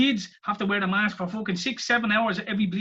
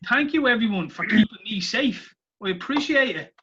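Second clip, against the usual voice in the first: mock gratitude; 5 semitones higher, 5 dB louder than usual. Speaker pretends to thank masked shoppers, mock gratitude. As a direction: gush thanks in a put-on way.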